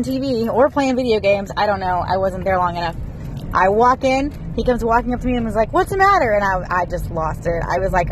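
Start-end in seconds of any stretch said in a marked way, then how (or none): 5.7-6.3 pitched way up, mock-innocent husband voice. Wife retelling her husband's casual greeting, mock innocent. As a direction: mimic him asking, high and clueless.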